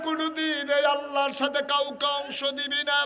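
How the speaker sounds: high, loud melodic exhortation; ringing sermon cry with held endings; full-force preaching. Real, not acted. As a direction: chant it out at full voice.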